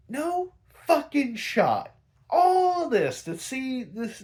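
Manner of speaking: hammed-up despair at a ruined plan; mock outrage. Penguin rants that his scheme was for nothing, loud and high.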